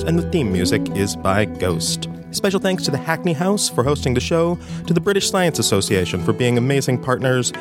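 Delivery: usual speaking voice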